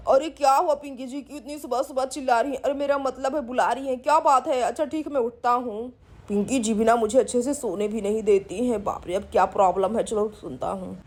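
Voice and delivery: a drowsy husband grumbling, pitched well down; grumpy sleepy man. Acted. Sleepy Siddharth woken up.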